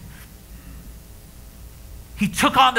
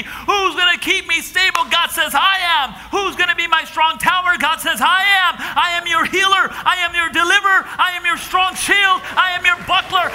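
preaching at full force; 5 semitones higher than usual. Acted. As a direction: drive each line home to the crowd.

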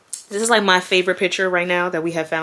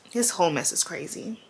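breathy sigh; 10 dB quieter than usual. Real, not acted. After crying over photo.

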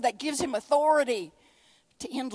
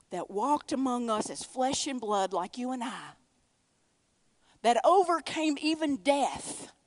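ordinary delivery

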